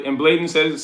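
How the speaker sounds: ordinary delivery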